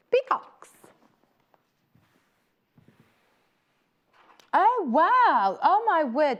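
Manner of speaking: high, loud thrilled exclamation; real thrill, high and loud; excited. Real, not acted. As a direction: gush over the peacocks. Sees peacock print.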